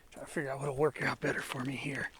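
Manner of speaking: whispered to himself; thinking aloud in a whisper. Real, not acted. Planning a route under his breath.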